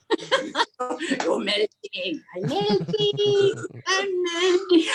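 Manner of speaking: high, drawn-out mock wail; hammed-up melting cry. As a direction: wail it as if dissolving away, playfully. Joking melting cries about the heat.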